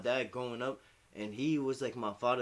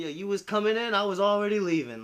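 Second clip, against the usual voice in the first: higher, louder mock of mom; mom's taunt; 8 semitones higher, 7 dB louder than usual. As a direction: tease like mom, a bit raised. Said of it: "She would tell me shit like".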